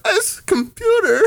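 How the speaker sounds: very high, slow, strangled squeak; mock starstruck panic. Salesman tongue-tied before his idol, mock panicked squeak. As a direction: imitate being too nervous to talk. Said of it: "freaking out and just couldn't talk".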